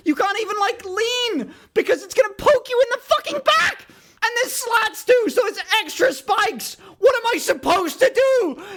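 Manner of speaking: very high, indignant ranting; squealing, exasperated comic meltdown; full crash-out. Real, not acted. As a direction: keep the pitch up in helpless outrage. Resumes crash-out rant after thanks.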